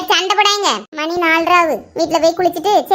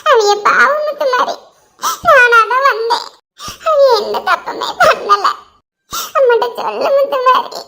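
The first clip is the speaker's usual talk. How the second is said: high, slow, tearful pleading; weeping and pleading for him; crying plea; 6 semitones higher, slower than usual. Acted. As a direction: beg through sobs. Girl pleads through tears.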